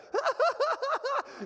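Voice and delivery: very high, loud hysterical cackle; hysterical laughter. Retold or imitated. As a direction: let forced laughter turn hysterical. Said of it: "it turned into hysterical laughter".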